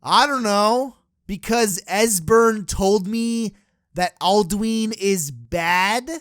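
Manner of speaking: hesitant, slow, trailing unsure answer. Retold or imitated. Player answering the dragon Parthenax, slow hesitant shrug. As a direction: stall and drag it out, unsure. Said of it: "And then it's like".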